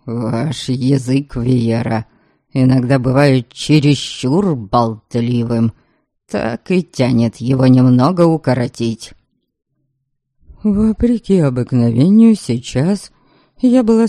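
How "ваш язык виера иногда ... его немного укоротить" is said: low, cold threat; menacing low man's voice; Norberg's voice. A narrator's reading. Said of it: "выплюнул Норберг".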